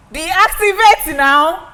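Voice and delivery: loud command. Pretend. Switching off the robot.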